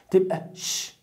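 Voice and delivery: ش as a long hushed sh hiss; sh sound demo. Shown as a demonstration. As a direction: hold the ش like a quiet shh. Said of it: "بتدي الساوند ش". (صوت الشين ممدود)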